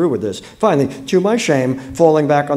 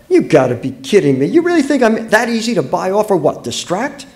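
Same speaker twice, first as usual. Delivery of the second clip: louder, pitch climbing in outrage; indignant disbelief; 7 semitones higher, 6 dB louder than usual. Acted. Wife rejecting her husband's gift, indignant. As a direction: throw it back at your husband, incredulous.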